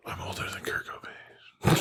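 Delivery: hushed aside; whispered. Real, not acted. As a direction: whisper it in awe.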